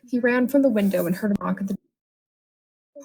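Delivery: plain reading voice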